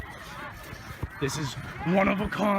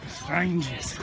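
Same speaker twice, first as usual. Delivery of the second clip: quiet stalking whisper-like voice; hushed; 6 dB quieter than usual. Acted. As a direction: keep it hushed while sneaking. Hushed sneaking narration.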